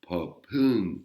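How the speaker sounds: popun with a breathy P and a rising tone. Shown as a demonstration. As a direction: push air after the P of popun and go up. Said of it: "une intonation qui monte et avec un H dans le P".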